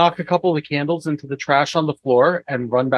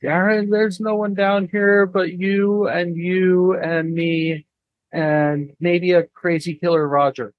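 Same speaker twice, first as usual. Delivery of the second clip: slow, higher, uneasy whisper-call; nervous character voice; 4 semitones higher, slower than usual. Acted. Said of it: "he's gonna be like".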